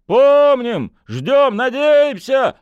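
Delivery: high, loud chanting calls; crowd shouting. Acted.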